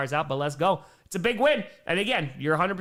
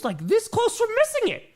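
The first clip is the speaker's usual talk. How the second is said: exasperated; 4 dB louder than usual. Real, not acted. Exasperated emphasis.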